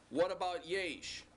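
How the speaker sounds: ordinary delivery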